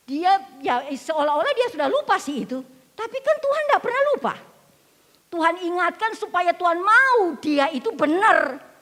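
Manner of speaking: high, loud preacher's cry; impassioned sermon delivery; full-force preaching. Acted. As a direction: preach it out to the congregation.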